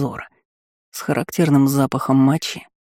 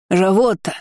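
mild, higher-pitched sobbed cry; sobbing; 5 semitones higher than usual. A narrator's reading. Shopkeeper spotting his witness in the crowd, sobbing. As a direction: call out to her, pleading tearfully. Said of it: "всхлипывал он".

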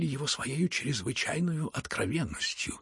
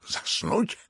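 high, affronted question; indignant cry; 9 semitones higher than usual. A narrator's reading. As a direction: cry out in offended disbelief. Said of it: "крикнул генерал".

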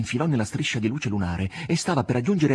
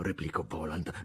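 plain reading voice

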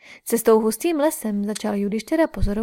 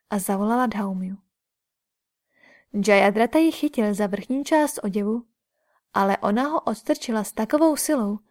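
usual speaking voice

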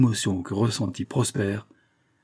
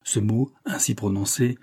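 plain reading voice

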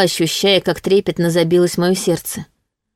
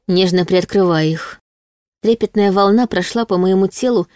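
plain reading voice

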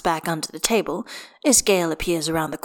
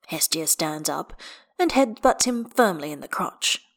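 plain reading voice

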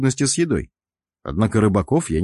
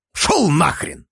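commanding curse; 7 semitones higher, much slower than usual. Acted. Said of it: "приказал я".

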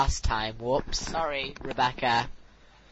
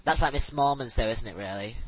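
usual speaking voice

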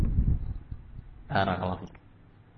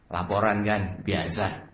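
meek report; 5 semitones lower, 6 dB quieter, slower than usual. Acted. Servant answers angry boss.

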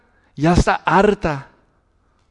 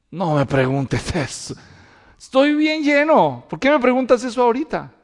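papá fastidiado; 6 semitones higher than usual. Retold or imitated. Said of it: "Y le decía".

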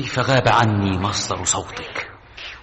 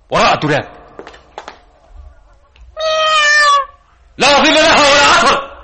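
rising, louder to a high scream; frantic yelling; 6 dB louder, faster than usual. Acted. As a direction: search in panic, then scream.

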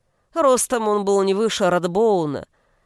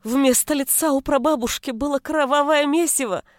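usual speaking voice

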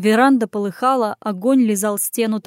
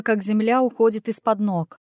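plain reading voice

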